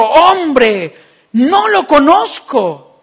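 loud, slow denial. Acted. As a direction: insist it loudly. Peter's second denial.

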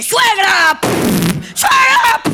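high, loud yell at each pound; furious shout. Retold or imitated. Mother pounds the steak, yelling at mother-in-law. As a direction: vent rage at the mother-in-law. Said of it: "de vez en cuando dice".

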